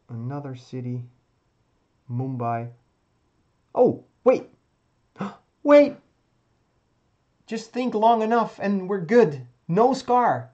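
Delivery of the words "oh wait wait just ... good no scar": sudden high excited gasp; light up as it clicks; excited realisation. Real, not acted. Sudden realisation of winning move.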